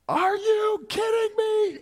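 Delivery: high, drawn-out shriek of disbelief; outraged yell. Retold or imitated. Wife yells at husband through car window, high outraged shout. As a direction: imitate an exasperated wife yelling. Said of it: "It was muffled by the window, or it would have been a lot louder".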